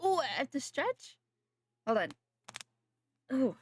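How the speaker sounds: usual speaking voice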